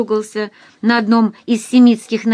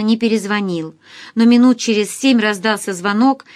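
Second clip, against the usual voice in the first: ordinary delivery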